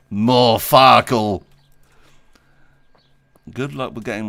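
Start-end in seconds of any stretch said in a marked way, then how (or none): 0.1-1.4 very slow, loud, stretched plea; drawn-out demand. Real, not acted. Player insists on the dice game, slow and loud. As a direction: drag the words out like a whiny demand.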